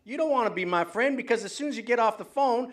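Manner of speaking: host heated with a prank caller; riled up. Real, not acted. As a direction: accuse the caller of baiting you, voice up. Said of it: "get me riled up".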